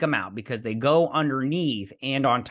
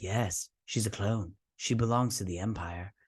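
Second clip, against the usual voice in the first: creepy doctor; 3 semitones lower, 7 dB quieter than usual. Retold or imitated. Doctor telling Crosshair the kid is a clone, quietly sinister. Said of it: "He's like".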